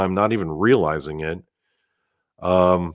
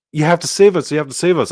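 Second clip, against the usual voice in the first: put-on insistent kids; 8 semitones higher, faster than usual. Kids insisting he save them.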